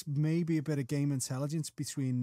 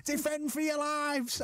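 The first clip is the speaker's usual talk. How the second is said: high, drawn-out mock scream; imitated scream; 10 semitones higher, slower than usual. Former player imitating team-mate before a corner, mock scream. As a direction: imitate a team-mate's high-pitched rallying yell. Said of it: "used to scream at the top of his voice".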